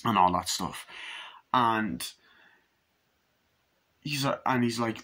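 ordinary talk

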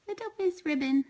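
high sweet coo to a kitten; cooing pet voice. Real, not acted. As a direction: lift it way up for the kitten.

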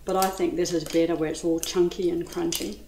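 ordinary talk

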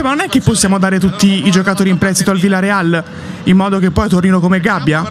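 ordinary talk, nothing marked

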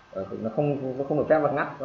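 ordinary talk, nothing marked

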